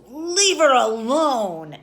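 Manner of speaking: slow, high, very loud shout; protective yell. Retold or imitated. Guy turns around to the screaming man, yelling. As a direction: bellow it, stretching every word. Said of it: "the guy yelled at him".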